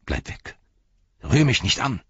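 sharp rising cry of warning; angry cry. A narrator's reading. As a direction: snap him away from the Ring. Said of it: "rief er".

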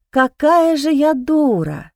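slow groaning self-reproach; inward groan. Acted. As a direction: moan it out slowly at yourself. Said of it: "мысленно застонала я".

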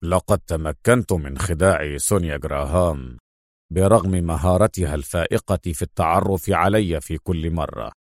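low-pitched, assured hero; self-assured secret agent. A narrator's reading. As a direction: recount the trick in a low voice. (صوت بطل منخفض واثق)

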